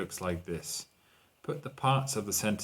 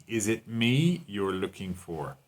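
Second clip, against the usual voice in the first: "me" stretched out and punched; slow delivery, "me" standing out strongly; stress on me; slower than usual. Shown as a demonstration. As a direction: put the emphasis squarely on "me". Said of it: "the emphasis is on me".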